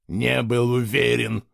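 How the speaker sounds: slow, scornful mimicry; contemptuous echo. A narrator's reading. Boy throwing friend's excuse back, contemptuous. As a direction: squeeze the words out with disdain. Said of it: "презрительно выдавил из себя Герик".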